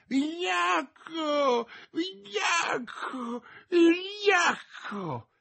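very high, strained, drawn-out cries; still crying out. A narrator's reading. D'Artagnan collapses in the road, still crying out. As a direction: wail it after him, stretched and faint. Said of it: "ancora gridando".

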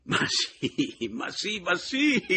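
climbing, laughing cry; hysterical, rising yell; frantic shout. A narrator's reading. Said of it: "gli gridai".

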